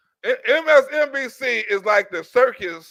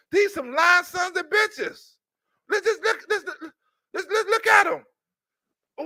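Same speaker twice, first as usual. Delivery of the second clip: higher-pitched, excited barker hawking a freak show; hyped carnival barker; 6 semitones higher than usual. Retold or imitated. Barker hawking the news channel's freak show, higher and hyped. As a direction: pitch it high and hype the freaks like a sideshow.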